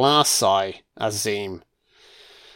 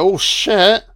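higher-pitched mock reaction; mock surprise; 8 semitones higher, 4 dB louder than usual. Vlogger voicing a film character's reaction, mock surprise. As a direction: voice his startled "oh" with a rise. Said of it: "he's like".

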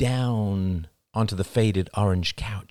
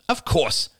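quick, higher-pitched snap; snappish; 8 semitones higher than usual. A narrator's reading. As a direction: snap back impatiently. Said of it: "snapped Crystal".

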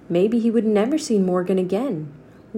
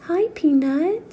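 cooing greeting; 9 semitones higher, slower than usual. Acted. Girl greets tiny mouse.

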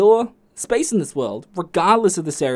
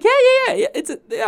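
high, loud rapid yeahs; high mock girlfriend; 4 dB louder, faster than usual. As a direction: chirp the yeahs fast and high. Girlfriend quoted.

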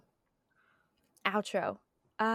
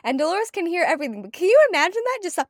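incredulous British rant, higher and louder; imitated British teacher; 8 semitones higher, 10 dB louder than usual. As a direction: do the British teacher, pitched up and loud. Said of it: "and he goes".

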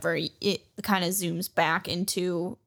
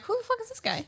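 much higher, squeaky what-the-hell voice; mock confused voice; 4 dB quieter, much faster than usual. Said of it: "And Yukimaru's like".

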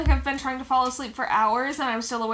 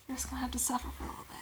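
fast breathy whisper; 15 dB quieter, much faster than usual. Acted. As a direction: whisper quickly into the mic. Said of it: "whispering into the microphone".

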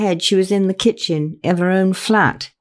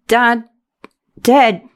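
high, halting, one word at a time; choked, managed words; 7 semitones higher, 4 dB louder than usual. A narrator's reading. Bereaved daughter breaking news to her friend, choked. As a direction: force each word out past a sob. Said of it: "Vicky managed at last".

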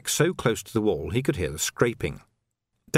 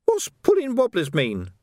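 high, sly, wheedling urchin voice; high-pitched cockney urchin hustling a copper; cheeky street urchin; 10 semitones higher, 4 dB louder than usual. Acted. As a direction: haggle like a grubby street kid. Urchin Nobby's voice.

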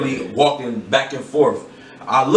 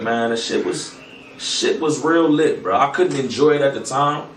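ordinary talk, nothing marked

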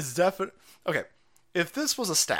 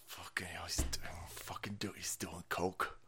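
hushed whispered curses, much softer; Jill whispering; 7 semitones lower, 13 dB quieter than usual. Retold or imitated. Jill whispering about her husband at the event, hushed whisper. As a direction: whisper it like a furious aside nobody should hear. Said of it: "She's sitting there going".

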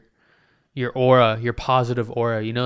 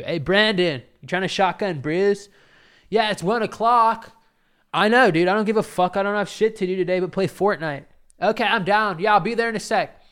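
higher, loud party-bro banter; bro voices; 8 semitones higher, 5 dB louder than usual. Acted. Bros planning to shotgun beers, hammed-up higher loud voices. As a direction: ham up two dudebros making plans.

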